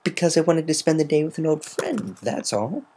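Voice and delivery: high, forced casual excuse; a lightly faked carefree tone; unconvincing. A narrator's reading. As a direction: fake a breezy excuse. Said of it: "far too long to make it convincing".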